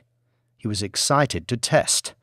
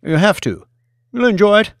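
louder, higher, jovial friend's voice; Billy, pushy and jovial; 6 semitones higher, 6 dB louder than usual. Acted. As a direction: brush off his doubts with loud cheer. Billy insists, loud and jovial.

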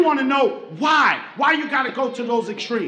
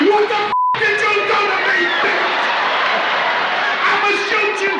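pained shout; 6 semitones higher than usual. Retold or imitated. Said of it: "I said".

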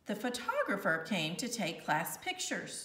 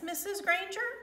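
a higher innocent question; innocent boy; 10 semitones higher, faster than usual. A narrator's reading. Schoolboy feigning innocence to teacher, higher. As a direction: ask sweetly, all innocence.

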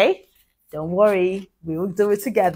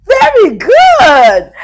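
excited praise; 11 semitones higher, 10 dB louder, much slower than usual. Real, not acted. Praising child.

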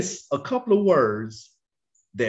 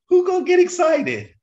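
calling out; 6 dB louder than usual. Real, not acted. Preacher calling out to congregation.

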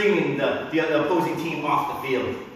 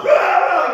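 sudden high, loud imitation yell; mimicked yell of the loudest man in the crowd; loud chanter's yell; 13 semitones higher, 9 dB louder than usual. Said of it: "he was, like, the loudest one".

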